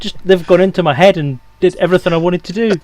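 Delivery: excited. Retold or imitated. Excited retelling of own thoughts.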